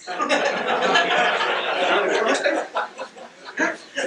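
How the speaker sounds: breathy high-pitched laugh. Real, not acted. Laughing at the elders' threat, high laugh. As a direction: burst out laughing. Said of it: "Again and again".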